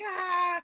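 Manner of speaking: high mock-dramatic "ah"; hammed-up high wail. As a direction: cry out over the top. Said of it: "it's fucking dramatic".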